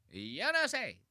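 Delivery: high, exaggerated mocking catchphrase; Tito Jerry impression. Reactor mocking comedian's character switch, high exaggerated impression. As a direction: mimic it in a high silly voice. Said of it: "Goes right into Tito Jerry".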